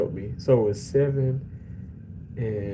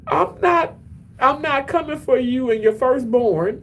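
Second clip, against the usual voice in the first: high mock-dramatic retort; 12 semitones higher than usual. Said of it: "and I w-, I said".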